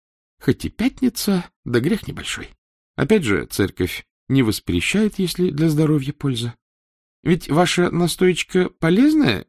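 lightly voiced old empress; a hint of a pampered empress.

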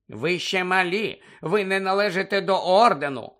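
hint of a mother's voice, mockingly; mimicking mother. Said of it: "перекривляючи материн голос".

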